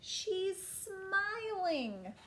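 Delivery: very slow, high, swooping exclamation; excited cry. A narrator's reading. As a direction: stretch it out as a delighted storybook king. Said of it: "cried the king".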